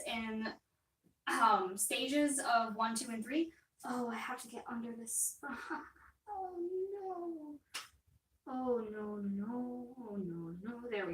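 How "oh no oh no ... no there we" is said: strained. Real, not acted. Struggling to get under canvas.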